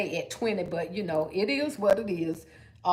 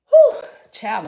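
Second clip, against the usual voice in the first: out of breath; 10 dB louder than usual. Real, not acted. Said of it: "I'm out of breath".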